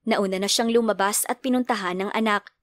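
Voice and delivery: ordinary delivery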